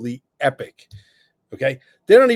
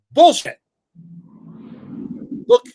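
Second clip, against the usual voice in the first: sudden loud scoff, much higher; loud outburst; 13 semitones higher, 5 dB louder than usual. Real, not acted. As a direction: spit out the word in disgust. Outburst dismissing politicians.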